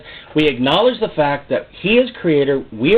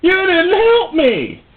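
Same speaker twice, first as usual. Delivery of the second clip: much higher, slow whine; whiny complaint; 4 dB louder, much slower than usual. Retold or imitated. Believer whining to God after falling, high and drawn out. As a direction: whine like a hurt child. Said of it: "come before God and go".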